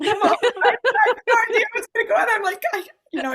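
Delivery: giddy laughing burst. Real, not acted. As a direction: laugh the words out helplessly. Laughing while retelling nerves.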